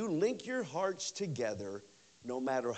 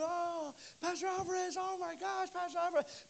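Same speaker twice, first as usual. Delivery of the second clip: high, gushing welcome; excited greeting; 13 semitones higher, 3 dB quieter than usual. Retold or imitated. Church members excitedly greet Pastor Alvarez again. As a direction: gush like a happy old friend.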